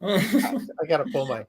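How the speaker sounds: slow, struggling through giggles; words broken up by laughter; laughing. Real, not acted. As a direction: try to regain composure while laughing. Helpless laughing fit on air.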